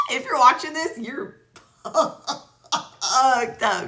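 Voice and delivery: high burst of laughter into the words; amused jab broken by laughs; laughing. Real, not acted. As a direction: crack up while talking. Cracks up addressing the thief.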